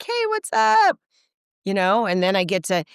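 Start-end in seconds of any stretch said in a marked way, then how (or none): 0.0-1.0 much higher, loud greeting; high bright hey what's up; cheerful reply. Retold or imitated. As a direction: call back happily. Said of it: "I'm like".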